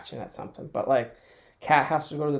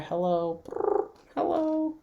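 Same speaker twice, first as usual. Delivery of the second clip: much higher, slow cooing pet voice; talking to the cat; 6 semitones higher, slower than usual. Real, not acted. To her cat that just beeped, high slow cooing.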